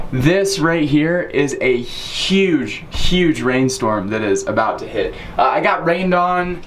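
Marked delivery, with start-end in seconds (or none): none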